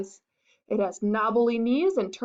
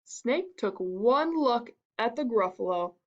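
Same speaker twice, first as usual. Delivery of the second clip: plain reading voice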